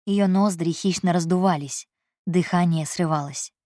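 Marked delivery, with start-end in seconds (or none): none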